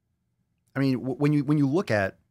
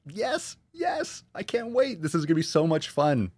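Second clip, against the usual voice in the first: very high, squealed yes; gleeful high-pitched squeal; squealing excitement; 8 semitones higher than usual. Real, not acted. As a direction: squeal with delight at the news. Gushing over a new member.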